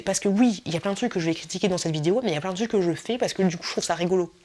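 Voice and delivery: ordinary talk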